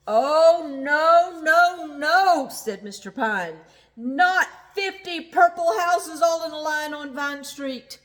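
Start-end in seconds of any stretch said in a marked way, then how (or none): 0.1-2.5 high, loud protesting "no, no, no"; Mister Pine protesting the copycat neighbours; dismayed cry. Acted. 3.9-8.0 high, loud protesting "no, no, no"; Mister Pine protesting the copycat neighbours; dismayed cry. Acted.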